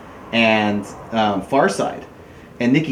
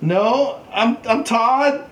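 meek mock reply; 11 semitones higher, much slower than usual. His meek answer, retold.

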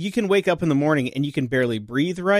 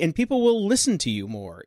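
normal talking voice

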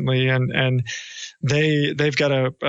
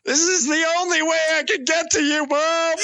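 laughing plea; 13 semitones higher than usual. Pretend. Mock plea to his mother.

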